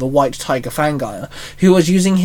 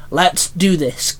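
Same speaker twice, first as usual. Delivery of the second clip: usual speaking voice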